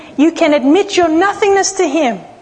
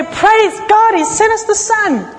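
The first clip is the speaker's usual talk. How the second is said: joyful praise; 6 semitones higher than usual. Retold or imitated. The cheerful farmer again.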